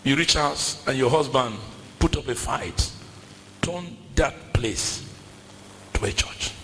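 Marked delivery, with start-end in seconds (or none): 3.6-6.5 slow hushed whisper; soft slow urging whisper. Acted. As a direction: drop to a secret whisper. Whispered repeat of exhortation.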